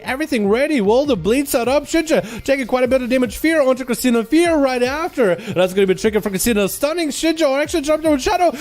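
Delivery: high, excited live commentary; play-by-play. Acted. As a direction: call the fight as it happens.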